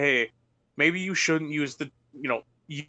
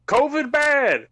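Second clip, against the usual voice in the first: loud, high, drawn-out outburst; slow it down and belt it out; exasperated high-pitched holler; emphatic exclamation; 9 semitones higher, 5 dB louder, slower than usual. Real, not acted. Emphatic rant about ignoring covid.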